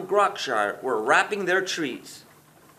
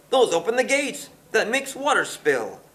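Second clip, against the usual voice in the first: Boris pitched up high, pointing at the wheels; villain pointing out the gates, lightly raised voice; 6 semitones higher than usual. A narrator's reading. Mayor Boris shows his helpers the wheels, in a raised voice. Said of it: "in a voice high and shrill".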